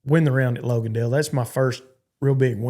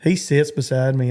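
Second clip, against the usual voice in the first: ordinary talk, nothing marked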